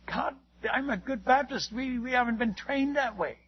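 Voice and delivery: acted-out hesitant protest; protesting excuse. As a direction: plead your case to God, mock earnest. Acts out excuse made to God.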